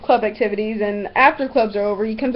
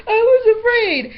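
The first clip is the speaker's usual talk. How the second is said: high, slow mocking whimper; mock whiny excuse; 9 semitones higher, 4 dB louder, slower than usual. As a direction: drag it out like a pathetic excuse, pitched up. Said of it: "because he's like".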